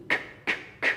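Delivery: whispered k, k, k; breathy unvoiced k, k, k sounds; a clipped k, k, k in a whisper; letter sound k. Shown as a demonstration. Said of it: "You got C".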